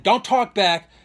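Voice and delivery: stern mock bark; toned-down angry cop. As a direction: reenact a cop's angry command, holding some back. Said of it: "not being as passionate and emotional".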